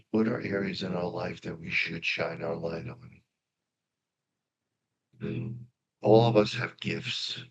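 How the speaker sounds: soft, unhurried meditation guide voice; guided meditation. Acted. Guiding the congregation into meditation, soft and calm. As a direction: ease them into stillness, softly.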